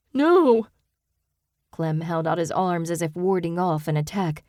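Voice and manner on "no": shouted high and loud; a frightened man yelling no; sharp panicked shout. A narrator's reading. Clem refuses in panic.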